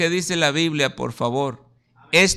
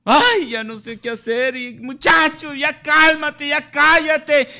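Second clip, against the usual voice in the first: helpless parent; 7 semitones higher than usual. Retold or imitated. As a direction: play the worn-out parent who can't cope. Helpless parent with unruly son.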